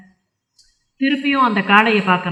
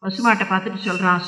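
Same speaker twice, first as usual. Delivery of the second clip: usual speaking voice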